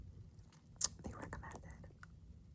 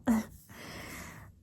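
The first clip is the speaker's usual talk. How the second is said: whispered laugh; 12 dB louder than usual. Acted. Laughs at own joke.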